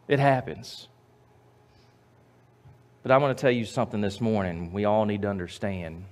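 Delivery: normal talking voice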